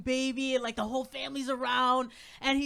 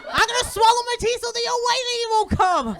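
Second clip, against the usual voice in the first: high, loud kid in hammed-up terror; mock freaked-out child; 11 semitones higher, 8 dB louder than usual. Comedian playing son dreading tooth fairy, mock terror. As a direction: protest like a panicking little boy. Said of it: "but I freaked him out".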